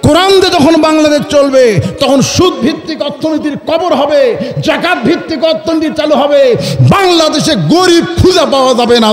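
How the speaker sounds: high, ringing sermon chant; full-force preaching. Acted. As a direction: proclaim it high to the crowd.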